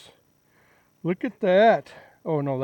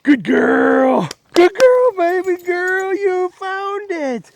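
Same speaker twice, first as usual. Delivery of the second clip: squeaky praising a dog; 14 dB louder, slower than usual. Real, not acted. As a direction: gush over the dog in a squeal. Praising the dog after the find.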